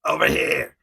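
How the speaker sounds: put-on shouted Jersey tough-guy accent. Sabretooth tells the folks to subscribe, Jersey accent. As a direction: bark it like a Jersey toy brute. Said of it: "he has, like, a Jersey accent".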